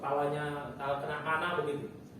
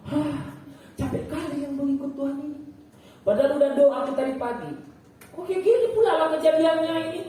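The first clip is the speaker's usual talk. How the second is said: high, loud mock grumbling; acted-out indignant moan; exasperated complaint; 9 semitones higher, 7 dB louder than usual. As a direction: whine loudly at how unfair it is. Voicing a weary complaint to God.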